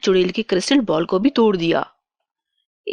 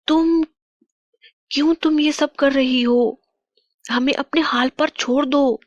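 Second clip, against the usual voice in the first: higher-pitched, distressed plea to a captor; pleading captive queen; 6 semitones higher than usual. Acted. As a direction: plead with the witch to let you be. Captive queen confronts the witch. (गिड़गिड़ाती हुई रानी, ऊँची आवाज़)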